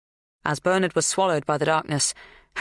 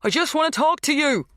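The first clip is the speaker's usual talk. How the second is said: high-pitched raised-voice plea; yelled appeal, pitched high; yelling; 11 semitones higher, 3 dB louder than usual. A narrator's reading. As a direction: call out to someone who is out of sight. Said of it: "he yelled instead".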